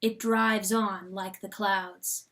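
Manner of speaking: ordinary delivery, nothing marked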